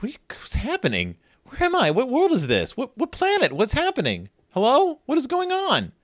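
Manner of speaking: high-pitched mock confusion; flustered string of baffled questions; bewildered. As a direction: act totally lost, voice jumping up high. Voicing bewildered Brother.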